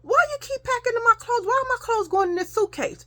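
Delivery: put-on high, whiny child's complaint to mama; a kid protesting her clothes being packed. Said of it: "So I say".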